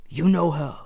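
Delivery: mildly accusing villain; understated, accented accusation. A narrator's reading. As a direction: make it an accusation. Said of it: "accused Sabri".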